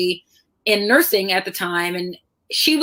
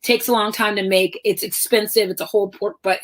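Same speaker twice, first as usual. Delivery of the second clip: usual speaking voice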